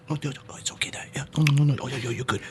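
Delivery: imitated whispering to a tiny creature; hushed, comforting murmur under the breath; soothing whisper. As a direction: whisper reassurance, very soft. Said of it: "talks and whispers to it".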